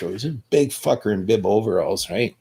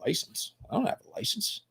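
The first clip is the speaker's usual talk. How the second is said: very high and soft, playing dumb; feigned innocence; 12 semitones higher, 14 dB quieter than usual. Telling the cop he has no license, faux-innocent squeak. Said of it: "And I say".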